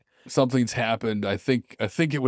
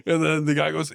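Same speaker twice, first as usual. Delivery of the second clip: ordinary delivery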